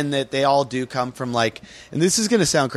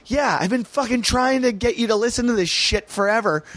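exasperated; 11 semitones higher than usual. Retold or imitated. Exasperated retort to wife.

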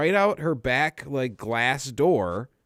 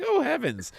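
mock prim gasp. Mocking prim shock.

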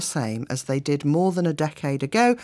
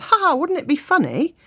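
idle joking; 11 semitones higher, 4 dB louder than usual. Retold or imitated. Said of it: "I just idly said".

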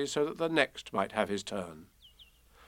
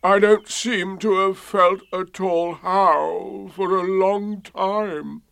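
slow, high, gloomy donkey voice; Eeyore; 7 semitones higher, 5 dB louder, slower than usual. A narrator's reading. Eeyore telling Pooh how he feels, gloomy. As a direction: mournfully stretch every word. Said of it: "in a gloomy manner to him".

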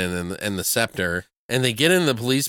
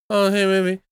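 higher opening sliding down, imitated sitcom ramble; mock casual suggestion with no urgency; Seinfeld; 5 semitones higher than usual. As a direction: ramble idly in a put-on sitcom voice. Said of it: "Like Seinfeld, you know?".

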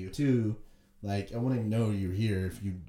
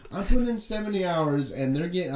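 raised, louder imitation of a griping worker; mock complaining; 6 semitones higher, 5 dB louder than usual. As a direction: air the grievance in a higher pitch. Acting out the complaining cleaner.

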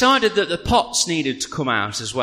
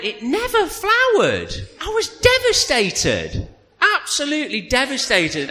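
mock devastated; 10 semitones higher than usual. Grief over failed peony.

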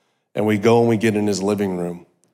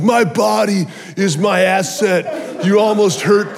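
higher, slow, half-sung mock indignation; prideful outrage; 8 semitones higher, slower than usual. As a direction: ham up the wounded pride, laughing at yourself. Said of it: "I'm like".

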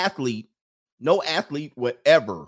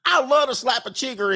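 pitched even higher and hammier; high mock Southern yokel; 11 semitones higher than usual. Southern local bragging about bugs, even higher hammed-up yokel voice. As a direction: push the yokel voice further.

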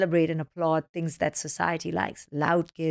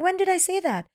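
put-on higher, innocent teen voice; puzzled daughter; 12 semitones higher than usual. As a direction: play the clueless kid. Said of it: "she was like".

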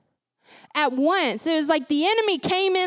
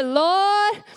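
grandma's Lord; 5 semitones higher than usual. Retold or imitated. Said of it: "You just hear".

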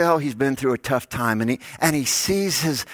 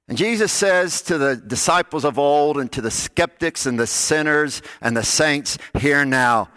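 ordinary talk, nothing marked

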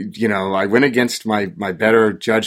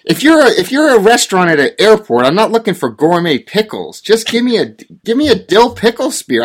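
exasperated rant; 8 semitones higher, 3 dB louder than usual. Real, not acted. Ranting about gourmet pickles.

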